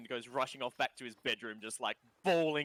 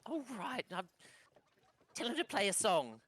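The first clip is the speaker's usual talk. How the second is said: put-on grudging kid; 7 semitones higher than usual. GM voices kid giving in.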